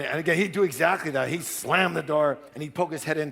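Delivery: normal talking voice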